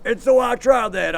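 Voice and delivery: normal talking voice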